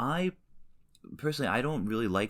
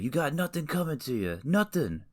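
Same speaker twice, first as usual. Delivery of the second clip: high-pitched bad gangster voice; badly faked tough guy, pitched way up; terrible mobster impression; 10 semitones higher than usual. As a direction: do a hammy TV mobster. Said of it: "Those are terrible impressions".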